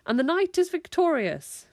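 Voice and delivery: high, loud mock-heroic proclamation; hammed-up knightly triumph; triumphant declaration. A narrator's reading. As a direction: declare victory like a play knight.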